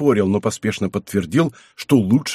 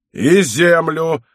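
slow, drawn-out angry echo; higher, heavy, slow bellow; roared retort; 5 semitones higher, 4 dB louder, much slower than usual. A narrator's reading. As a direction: throw it back as an irate roar, stretched out. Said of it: "рявкнул аббат".

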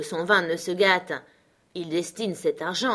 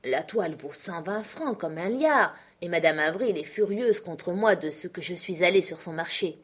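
usual speaking voice

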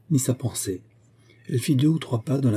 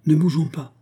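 higher-pitched exclamation; crying out; 7 semitones higher, 4 dB louder than usual. A narrator's reading. Constantin warns the others in the fog, crying out. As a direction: cry out a warning. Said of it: "s'écria Constantin".

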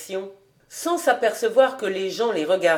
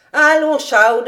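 loud, high urging; brisk call to action; rousing exhortation; 5 semitones higher, 7 dB louder than usual. A narrator's reading. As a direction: spur a friend to get up. Rabbi rouses Fritz to action.

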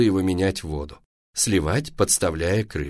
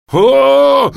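very loud, high drawn-out scream; long shriek of alarm; 13 dB louder than usual. A narrator's reading. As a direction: scream yourself awake in horror. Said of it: "орал папа".

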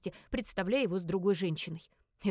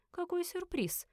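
playful; 7 semitones higher, 5 dB quieter, slower than usual. A narrator's reading. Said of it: "игриво ответила гостья".

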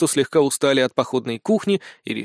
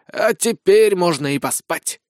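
slow, raised, satisfied announcement; contented; 4 semitones higher, slower than usual. A narrator's reading. Sergeant heads to bed after feasting, contented. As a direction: draw it out with well-fed satisfaction. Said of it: "довольно заявил Сисюля".

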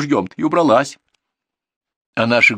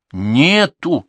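firm, louder "Нету!"; insistent; 6 semitones higher, 7 dB louder than usual. Acted. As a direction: repeat it stubbornly. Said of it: "настойчиво повторил внук".

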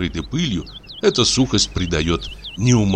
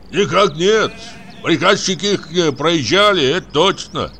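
raised, high-pitched voice; called-back answer; 10 semitones higher than usual. Acted. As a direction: shout the report back across the field. Shepherd calls back an answer.

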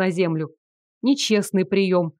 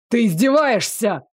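mildly raised, outraged yell; narrated werewolf's angry outburst; indignant yell. A narrator's reading. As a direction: yell back in disbelief, held in by narration. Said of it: "заорал в ответ оборотень".

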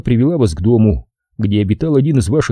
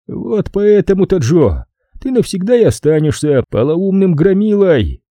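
high, exasperated bark; barked rebuke; 10 semitones higher than usual. A narrator's reading. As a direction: snap the rebuke out, pitched well up. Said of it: "рявкнул Фаулер". (рявкнуть раздражённо, высоким голосом)

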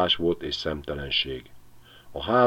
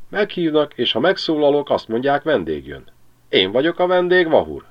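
cheeky magpie, much higher and louder; taunting magpie; 8 semitones higher, 4 dB louder than usual. Acted. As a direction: tease the dog from the rooftop. (a szarka gúnyosan csúfolja a kutyát)

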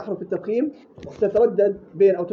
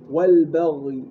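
ordinary delivery

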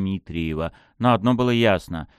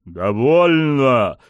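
long, loud, raised roar; barked shout; 4 semitones higher, 6 dB louder, much slower than usual. A narrator's reading. Pushkin cuts the lady off, barked shout. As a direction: roar to end her taunts. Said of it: "рявкнул Пушкин".

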